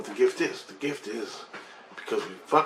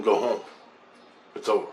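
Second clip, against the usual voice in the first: usual speaking voice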